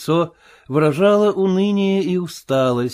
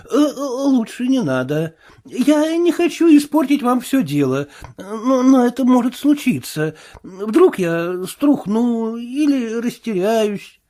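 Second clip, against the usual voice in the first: frightened tramp; 9 semitones higher than usual. Acted. Marvel excusing himself to the invisible man, higher whine. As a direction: beg like a bruised coward.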